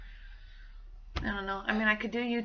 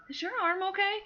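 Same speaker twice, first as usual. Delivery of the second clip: higher, tentative little question; 10 semitones higher than usual. Retold or imitated. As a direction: ask it lightly, pitched up. Retells her tentative question to someone.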